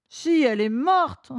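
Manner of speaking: put-on bawling little boy, sobs after the words; hammed-up childish crying; tearful child. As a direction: insist through tears, then break into sobs. Boy sobbing inconsolably, recognizer heard laugh.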